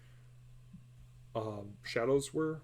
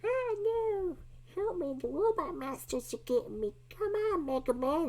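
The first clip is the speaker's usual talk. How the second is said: much higher, louder hammed-up cry for help; mock panicked screaming; 4 dB louder than usual. Imitating people screaming for Mega Man to rescue them. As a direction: squeal like a cartoon victim calling a hero. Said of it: "people screaming like".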